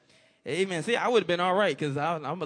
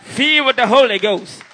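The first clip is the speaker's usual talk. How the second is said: smug mock believers; 7 semitones higher, 11 dB louder than usual. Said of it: "some folks think".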